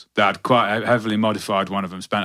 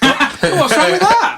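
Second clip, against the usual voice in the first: laughing; 5 dB louder, much slower than usual. Real, not acted. Mock outrage over [name] jab.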